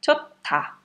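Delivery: chota com o ch forte e aspirado, em tom alto; aspirated T. Shown as a demonstration. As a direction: solta o ar no ch de chota. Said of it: "vai virar aspirado". (chota dito com ch aspirado e agudo)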